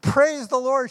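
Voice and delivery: cried out. Acted. Old lady finding groceries on her porch, delighted cry. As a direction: burst out in delight at the gift. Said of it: "she cried out".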